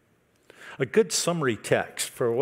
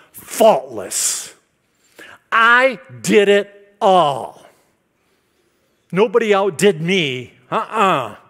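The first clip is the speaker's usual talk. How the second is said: loud, high boasting voice; boastful preaching; 5 semitones higher, 8 dB louder than usual. Acted. Preacher voicing Paul's proud boast, loud and high. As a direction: brag like a proud Pharisee.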